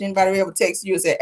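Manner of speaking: ordinary delivery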